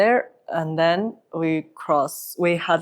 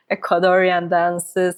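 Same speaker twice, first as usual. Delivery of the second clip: usual speaking voice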